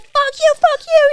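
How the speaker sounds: high, loud mock-furious shouting; mad housemate imitation. Housemate cursing the late oversleeper, imitated furious yell. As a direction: hurl it out as the raging housemate. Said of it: "He's so mad".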